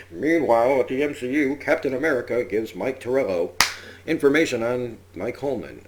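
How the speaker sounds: hammed-up "meanwhile" announcer voice; mock superhero announcer. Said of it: "which is hard to say 'cause it's like".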